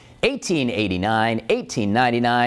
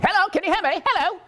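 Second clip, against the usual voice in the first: put-on old-timey caller. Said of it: "Roaring Twenties".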